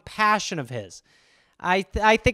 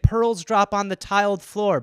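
ordinary delivery

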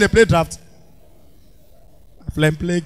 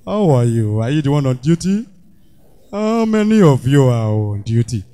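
much lower, heavy deep voice; thick voice; 9 semitones lower than usual. Shown as a demonstration. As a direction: drop the voice low and heavy. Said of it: "thick your voice".

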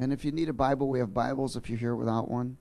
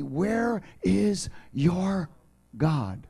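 slow, drawn-out taunting question, voice pitched up; mocking enemies; 5 semitones higher, much slower than usual. Preacher voicing the enemies' taunt, slow and mocking. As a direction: taunt it slowly like a scornful enemy. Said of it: "they continue to say to me".

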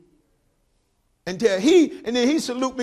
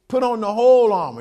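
1 emphatic preaching; 7 dB louder than usual. Real, not acted. Preaching the scripture loudly.

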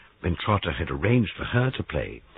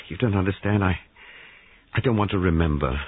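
ordinary delivery, nothing marked

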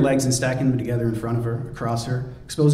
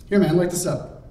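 lightly voiced Drago; 6 semitones higher than usual. Drago tosses joint.

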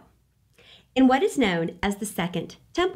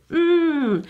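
high, loud delighted mm; yummy mm; 6 semitones higher, 5 dB louder than usual. Real, not acted. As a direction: savour the taste out loud. Describing yummy fried food.

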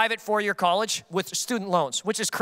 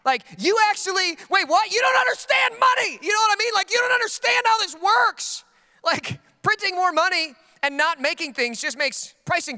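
exasperated yell; 9 semitones higher than usual. Retold or imitated. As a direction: shout it in disbelief, slowing on the explanation. His own retold exasperated rant.

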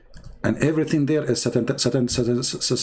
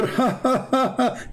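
high mocking cackle of the caller; much higher imitated chuckle; imitated laugh; 11 semitones higher than usual. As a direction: mimic his giggle. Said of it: "He was laughing".

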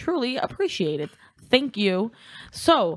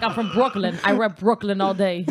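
mock Brooklyn brag. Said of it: "I'm like".

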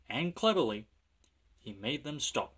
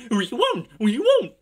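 high, loud squealed refusal; screaming trucks; 12 semitones higher, 12 dB louder than usual. A narrator's reading. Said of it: "screamed the trucks".

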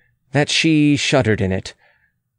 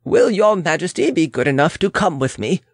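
courteous official; 6 semitones higher than usual. Acted. Official voiced in a story.

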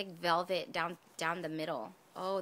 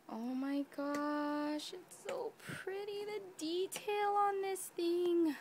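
awed gushing; 8 semitones higher than usual. Real, not acted. Admiring the detail on this thing, awed gushing. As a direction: marvel at it in a high coo.

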